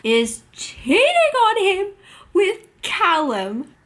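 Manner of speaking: high, loud scandalized exclaim. Real, not acted. Viewer reacts to the wife cheating, scandalized. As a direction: stress it like juicy gossip.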